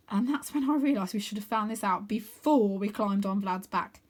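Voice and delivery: ordinary delivery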